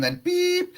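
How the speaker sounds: high beep sound; a quick high beep. Shown as a demonstration. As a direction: make the voicemail beep. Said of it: "act that you've been waiting".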